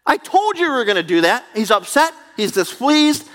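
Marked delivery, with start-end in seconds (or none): 0.1-1.4 higher, whiny complaint to God; sulking Jonah. Acted. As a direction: complain like a prophet who knew it. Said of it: "and Jonah is saying".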